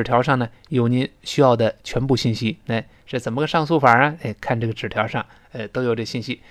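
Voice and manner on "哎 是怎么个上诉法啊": normal talking voice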